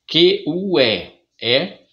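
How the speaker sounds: "Q U E" spelled letter by letter, slow and loud; spelling out slowly. Shown as a demonstration. Teacher spelling out "Q U E" slowly for children. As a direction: spell Q, U, E out for the class. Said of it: "que, tá certo".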